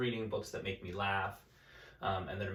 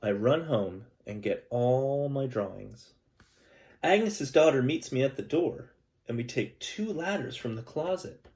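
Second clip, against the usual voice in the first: louder, gentle read-aloud storytelling voice; story narration; 2 semitones higher, 7 dB louder than usual. Acted. Teacher reading a picture book to her class, storytelling voice.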